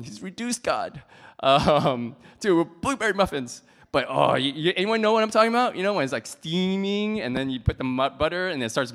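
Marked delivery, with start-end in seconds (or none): 0.0-3.6 louder scandalised-critic voice; mock outrage. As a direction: imitate an appalled listener, loudly. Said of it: "Some of you are like".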